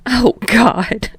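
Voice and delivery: breathless wheeze of laughter; wheezing laugh. A narrator's reading. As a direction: gasp it out laughing. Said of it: "Sarah wheezes".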